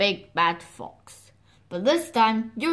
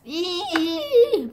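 normal talking voice

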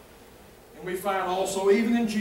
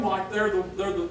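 normal talking voice

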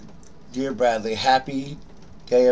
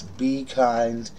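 usual speaking voice

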